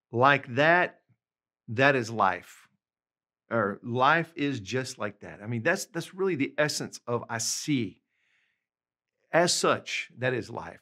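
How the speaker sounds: ordinary talk, nothing marked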